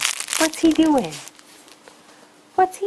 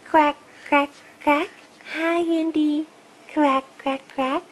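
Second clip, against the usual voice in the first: toy duck voice; 6 semitones higher than usual. Pretend. Toy duck greeting baby.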